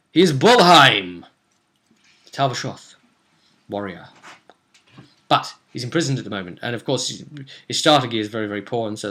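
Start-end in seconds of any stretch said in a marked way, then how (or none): 0.1-4.1 dramatic announcement. Real, not acted. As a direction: proclaim the character's name. Big reveal of new character.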